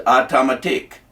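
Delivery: "automatic" in imitated West Indian pronunciation; West Indian accent. Imitating a West Indian mate saying "automatic", in his accent. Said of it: "had West Indian accents".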